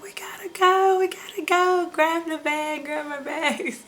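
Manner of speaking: re-enacted frantic urging, pitched right up; urgent retold plea. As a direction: hurry him out in a mock panic. Frantically rushing husband to hospital, retold.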